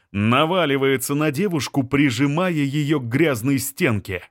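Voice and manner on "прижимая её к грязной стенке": ordinary delivery, nothing marked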